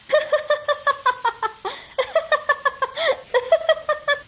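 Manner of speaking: long, high cackling laugh; hearty mocking laughter. Boy laughing at the fooled villagers, hearty high laughter. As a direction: laugh hard in high, rapid bursts. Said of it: "जोरजोरात हसायला लागला".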